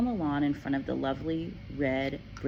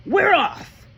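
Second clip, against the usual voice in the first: loud barked announcement; gruff shout; 11 dB louder than usual. A narrator's reading. Father shouts at Matilda while stuffing suitcases. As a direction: bark it over the packing.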